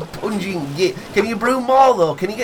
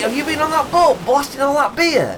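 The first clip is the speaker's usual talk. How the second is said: ordinary talk, nothing marked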